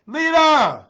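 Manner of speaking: high, very loud drawn-out name call; excited holler. Real, not acted. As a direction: holler the name across the miles. Hollers viewer's name in delight.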